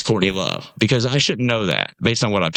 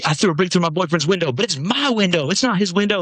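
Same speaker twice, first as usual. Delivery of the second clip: higher-pitched imitation of an indignant excuse; retold protest, pitched up; mock self-justifying; 7 semitones higher than usual. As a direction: argue it like it's perfectly reasonable. Retelling her defensive self-justification.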